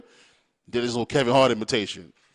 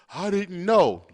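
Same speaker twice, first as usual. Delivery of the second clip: higher, louder whiny denial; mock denial; 6 semitones higher, 4 dB louder, much slower than usual. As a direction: sneer the excuse back at the parents. Mocking parents' excuse.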